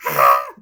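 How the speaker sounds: sudden low, loud cartoon yell; loud low shriek. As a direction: shriek in shock. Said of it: "He shrieked".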